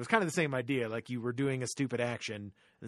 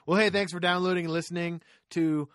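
louder, higher announcer voice; podcast outro; 5 semitones higher, 7 dB louder than usual. Acted. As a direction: hype up the sign-off like a radio host.

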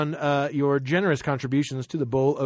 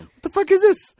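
high, baffled what-the-heck; baffled outburst; 4 dB louder than usual. Retold or imitated. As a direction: squeal it in disbelief. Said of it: "And it was like".

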